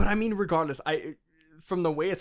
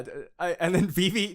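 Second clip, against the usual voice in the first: ordinary talk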